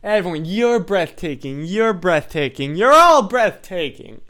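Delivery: Keanu quote. Retold or imitated. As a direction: proclaim it to everyone, grandly. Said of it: "Keanu's saying".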